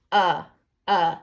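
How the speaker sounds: "Uh, uh" as isolated short vowel sounds; sounding out. Shown as a demonstration. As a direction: stretch "Uh" as a clean vowel sound. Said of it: "You says uh".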